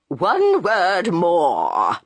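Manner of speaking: put-on a slow, trembling old man's plea; quavering voice. A narrator's reading. Old witness begging the court's attention, quavering. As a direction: quaver each word out slowly. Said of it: "he said in a quavering voice".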